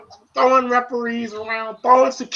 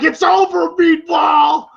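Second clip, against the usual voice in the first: high, taunting yell; mock wrestler shout; 8 semitones higher, 4 dB louder, slower than usual. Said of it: "He's like".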